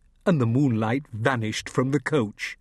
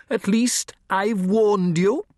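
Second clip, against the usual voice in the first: high, slow, raised warning; raised over noise; 9 semitones higher, 4 dB louder than usual. A narrator's reading. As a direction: call it over the clatter. Larson over the clamour.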